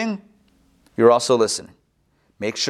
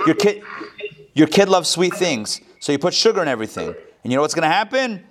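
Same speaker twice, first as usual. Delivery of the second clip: ordinary talk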